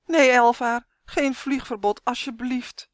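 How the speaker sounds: high, loud pleading whine; wailing plea. A narrator's reading. Yves begging Elvaar not to ground him, wailing. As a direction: beg desperately against the punishment. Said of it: "jammerde hij".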